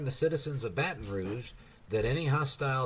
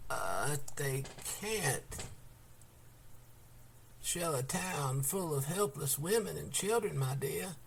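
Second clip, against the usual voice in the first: put-on soft, slow old lady's whisper; gentle protest; 3 semitones higher, 7 dB quieter than usual. A narrator's reading. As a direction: protest tenderly and softly. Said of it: "protested gently".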